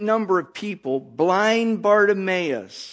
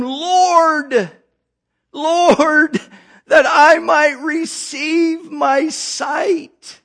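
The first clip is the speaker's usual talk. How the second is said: tearful plea, higher and louder; pleading cry; 5 semitones higher, 4 dB louder, much slower than usual. Acted. Blind man begging Jesus for sight, tearful pleading cry. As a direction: beg the Lord to see again. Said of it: "The blind man said unto him".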